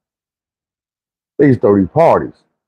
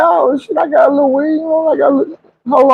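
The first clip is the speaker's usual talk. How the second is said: high, excited offer; suddenly keen to share; retold high eager offer.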